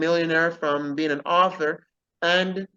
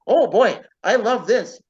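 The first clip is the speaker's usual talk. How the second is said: retold gleeful exclamation, louder and higher; delighted professor; 4 semitones higher, 6 dB louder than usual. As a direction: imitate the professor's eager delight. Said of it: "And he goes".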